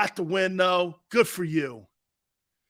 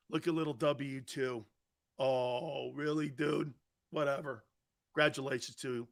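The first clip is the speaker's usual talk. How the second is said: low, quiet, unimpressed aside; dismissive; 5 semitones lower, 10 dB quieter than usual. Real, not acted. Host dismisses an online tough guy, low and dismissive. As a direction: drop it low and soft, brushing them off.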